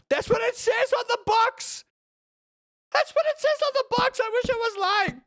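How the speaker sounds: very high, squealing exasperation; squealed protest. Pretend. Streamer defending the box slogan, high squealed protest.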